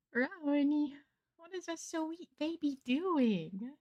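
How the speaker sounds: high, slow cooing pet voice. Real, not acted. Cooing at her cat.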